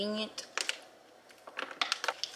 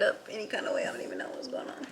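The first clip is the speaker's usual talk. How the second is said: whispering; 9 dB quieter, faster than usual. Real, not acted. Quiet aside about her hair.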